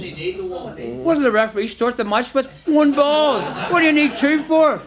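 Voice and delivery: mock indignation that spills into laughter; laughing mock outrage. Captain scolding Billy about the spare ball, laughing mock outrage.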